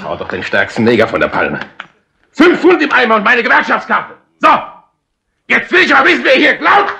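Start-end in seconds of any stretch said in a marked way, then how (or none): 2.3-6.9 high, loud demanding yell; furious, loud demand to know the thief; angry shout. Acted. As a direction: demand it at the top of his voice. Barman furious about stolen money.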